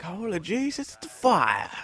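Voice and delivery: high, hammed-up cry of alarm; mock panic. Teen jokingly pretending the house is on fire, hammed-up panic. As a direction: fake a panicked shout about fire.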